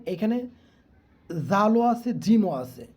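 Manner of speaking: ordinary talk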